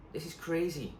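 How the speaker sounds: a hint of an anxious young girl; lightly voiced girl.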